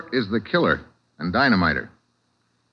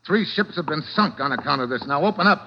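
threatening; 5 semitones higher than usual. Acted. Detective to Surratt, higher and threatening. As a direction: squeeze him until he talks.

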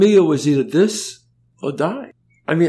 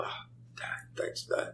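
breathy whispered thank-you to dad; 17 dB quieter than usual. Retold or imitated. Son whispering thanks to dad for the money, hushed whisper. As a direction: whisper it so nobody wakes up. Thanking parents late at night.

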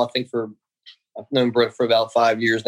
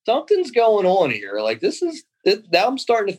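excited realization; 8 semitones higher than usual. Real, not acted. Telling the host he sensed his chance, high and slowed. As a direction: let the thrill creep in as it dawns on you.

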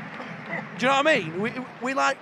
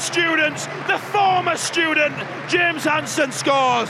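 excited goal call; 5 semitones higher, 4 dB louder, slower than usual. Real, not acted. Calling the goal.

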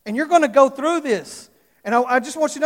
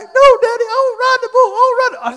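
put-on high, louder stubborn little-boy whine; whining child refusing; 13 semitones higher, 9 dB louder than usual. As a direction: refuse like a scared son. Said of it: "He's like".

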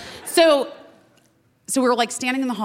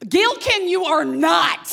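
laughing mock scold; 9 semitones higher, slower than usual. Mock scolding Birdie at the show.

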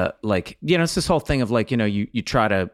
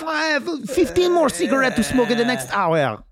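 a put-on waiter voice, much higher; high mock French waiter; 12 semitones higher than usual. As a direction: act the bored smoker waiter.

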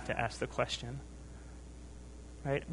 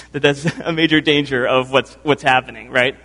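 louder restart regaining composure; recovering; 8 dB louder than usual. Real, not acted. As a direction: push on firmly after laughing. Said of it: "I'm sorry".

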